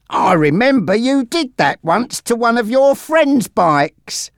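high-pitched amused henchman; smiling. A narrator's reading. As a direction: reminisce fondly with the boss. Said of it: "said Monty, smiling".

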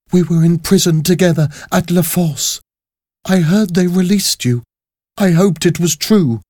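put-on high, earnest young woman's voice; high-voiced, hopeful prisoner. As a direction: pitch it high, pleading gently to a fellow prisoner.

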